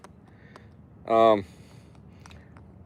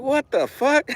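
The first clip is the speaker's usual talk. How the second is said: much higher, incredulous outburst; mock disbelief; 10 semitones higher, much slower than usual. Telling viewers about a donation-funded purchase, incredulous. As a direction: blurt it in baffled, amused disbelief. Reacting to the absurd donation story.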